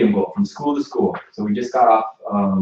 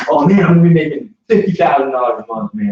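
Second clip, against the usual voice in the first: hyped-up boast; 5 semitones higher, 7 dB louder than usual. Retold or imitated. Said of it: "and I was like".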